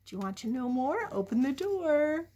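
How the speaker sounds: ordinary delivery, nothing marked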